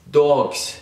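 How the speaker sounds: usual speaking voice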